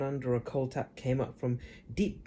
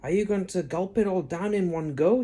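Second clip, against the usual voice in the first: George asking; 5 semitones higher, 5 dB louder than usual. A narrator's reading. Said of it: "George asked her".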